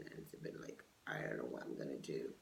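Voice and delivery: unvoiced whisper through a grimace; grimacing whisper. Real, not acted. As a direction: whisper it, squirming with discomfort. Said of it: "you see me grimacing".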